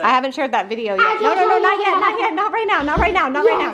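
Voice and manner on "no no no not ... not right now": higher, fast no no no; urgent pleading. Real, not acted. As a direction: stop him before he shows it. Stopping son showing a video.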